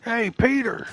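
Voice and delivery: loud, high bellowing of the name; neighbor yelling through walls. Retold or imitated. Mullet neighbor hollering at Peter through the wall, loud yell. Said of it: "That's what I think of anytime I see the name Peter".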